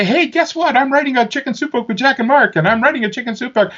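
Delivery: high-pitched giddy boasting; acted-out excited announcement, very high; excited bragging. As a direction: gush it like big news. Retold boasting to hometown friends.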